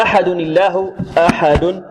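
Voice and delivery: slow, drawn-out recitation; Quranic recitation. Shown as a demonstration. Teacher reciting slowly to show the students. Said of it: "هذه الدال فيها ماذا؟ تنوين".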